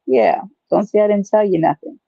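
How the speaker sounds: usual speaking voice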